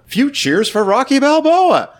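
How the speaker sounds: high, slow announcer's call; imitating the announcer. Podcaster quoting the fight announcer as the crowd turns, high drawn-out call. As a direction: draw it out like a ring announcer. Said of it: "You hear the announcer go".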